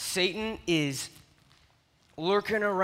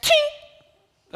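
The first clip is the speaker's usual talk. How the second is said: shovel clink; 8 dB louder than usual. Retold or imitated. Shovel hits something.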